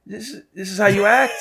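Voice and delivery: high-pitched mock disbelief; hammed-up incredulous questioning. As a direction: react in disbelief to someone's rudeness. Retold reaction to rude clerk.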